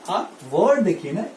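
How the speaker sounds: ordinary talk, nothing marked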